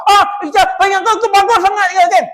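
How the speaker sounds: high, loud scolding outburst; mock angry yell. Staff member suddenly yelling at Ica, mock fury. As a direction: snap at Ica like you've lost it.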